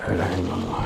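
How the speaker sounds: a murmured sigh of faith; muttered prayer. Acted. Brother arriving home, muttering a prayer softly.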